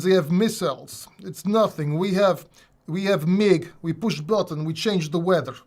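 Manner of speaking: a light put-on Russian accent; lightly voiced Russian accent bragging about bear power. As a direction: boast like a comic Russian tough guy. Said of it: "I know I'm doing a Russian accent".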